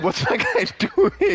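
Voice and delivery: high, slow, laughing disbelief. Retold or imitated. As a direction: laugh through the question. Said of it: "I was like".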